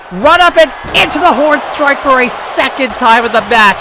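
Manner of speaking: loud, high call of the finish; excited shout. Real, not acted. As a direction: belt out the big move. Finisher hits, commentator shouts.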